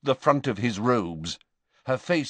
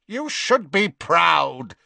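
high, hard and cold; ruthless Bellatrix; 9 semitones higher, 5 dB louder, slower than usual. A narrator's reading. Bellatrix scorning her weeping sister, ruthless. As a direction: sneer it down at her. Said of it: "said Bellatrix ruthlessly".

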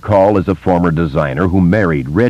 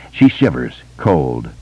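usual speaking voice

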